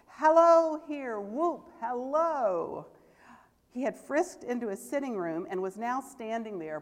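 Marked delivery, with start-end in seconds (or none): 0.1-2.9 loud, high, drawn-out hello of pure glee; joyous shout. A narrator's reading. Scrooge giddy with joy, shouting hello to the world. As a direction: bellow hello like a man gone giddy.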